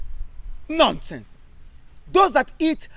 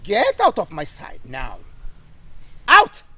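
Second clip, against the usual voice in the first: angry dismissal; 7 semitones lower than usual. Acted. Captain angrily yelling at the recruit to leave. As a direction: throw him out with a shout.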